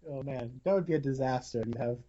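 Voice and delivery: ordinary talk, nothing marked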